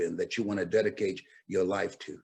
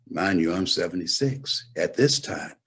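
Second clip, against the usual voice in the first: ordinary delivery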